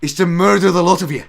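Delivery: put-on ranting Scottish accent as Willie, loud; Scottish Willie shout. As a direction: roar it like a mad Scottish groundskeeper. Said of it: "Oh, Willie".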